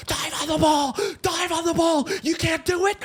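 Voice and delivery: imitated angry coach. College coach yelling at his team, mock angry shout. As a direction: bark orders like a mad coach. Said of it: "he got mad at us".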